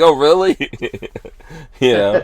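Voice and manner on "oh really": higher, louder playful surprise; mock surprise. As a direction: act surprised, tongue in cheek. Retelling reaction to a fan.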